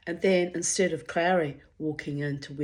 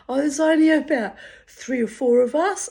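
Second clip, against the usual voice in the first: high, loud mock innocence; imitating a sheepish man fudging the numbers; mocking the caller; 9 semitones higher, 9 dB louder than usual. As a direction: play him down-playing it, hammed up. Ex voiced downplaying.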